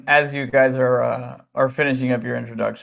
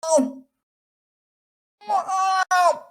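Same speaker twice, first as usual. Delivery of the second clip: pained meow. Acted. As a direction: meow like a cat in pain. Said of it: "Is that better?".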